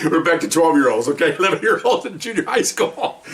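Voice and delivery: high, laughing through the words; can't stop laughing while teasing. Real, not acted. Laughing while telling the viewers about the recruit.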